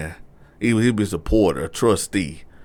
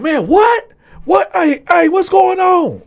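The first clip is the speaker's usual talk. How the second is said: high, loud disbelief; shocked yell; 7 dB louder than usual. Retold or imitated. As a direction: yell it out, stunned. Dude reacting in shock.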